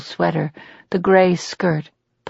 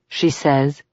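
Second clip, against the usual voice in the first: ordinary delivery, nothing marked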